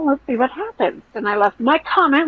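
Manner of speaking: ordinary talk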